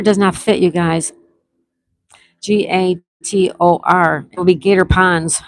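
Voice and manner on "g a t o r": slow letter-by-letter spelling. Shown as a demonstration. Host spells out the word for the players, slowly.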